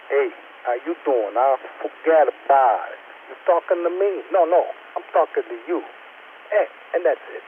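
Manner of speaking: put-on a mild Italian-American mobster accent, slowed down; Italian-American impression. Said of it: "trying to imitate an Italian".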